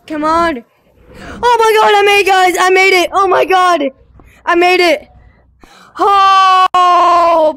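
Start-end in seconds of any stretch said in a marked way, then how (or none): 1.2-7.5 loud, high overjoyed shouting; relieved laughter. Real, not acted. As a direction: scream with joy at finally making it. Made the jump after struggle.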